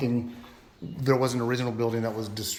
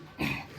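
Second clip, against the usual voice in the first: throat clear; 4 semitones higher, 4 dB quieter than usual. Real, not acted. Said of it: "oh, my voice keeps going".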